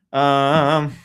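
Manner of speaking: normal talking voice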